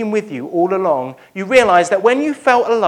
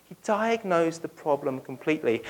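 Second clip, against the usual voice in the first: softer, settled echo; quiet restatement; 8 dB quieter than usual. Shown as a demonstration. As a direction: let it sink in softly. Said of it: "repeated phrase".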